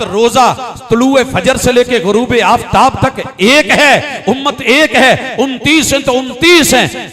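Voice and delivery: loud rhythmic list, climbing in pitch; full-force preaching. Real, not acted. Preacher tells congregation fast and Eid are one, building forceful preaching. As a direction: drive each phrase home, building to a peak.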